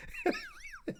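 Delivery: a squeaky high giggle; a quick high-pitched laugh. Real, not acted. Laughing high at a viewer's joke, giggle.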